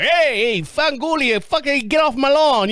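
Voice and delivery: mock holler like a loud Jersey guy. Jersey neighbor chasing someone off his lawn, imitated loud guy. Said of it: "He's a loud guy. Loud guy".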